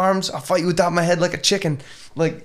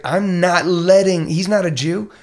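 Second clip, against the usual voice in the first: firm, louder and drawn out; defiant stand; 5 semitones higher, 6 dB louder, slower than usual. Retold or imitated. Bonhoeffer refusing to let it happen, defiant. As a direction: voice a man refusing to back down.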